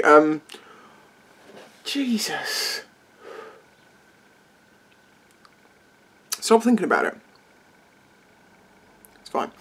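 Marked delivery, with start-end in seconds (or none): 1.8-9.5 soft strained self-talk; hushed, calming yourself down; quiet self-talk. Real, not acted. Burn returns, talking to self.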